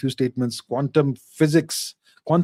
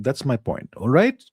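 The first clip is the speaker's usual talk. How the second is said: usual speaking voice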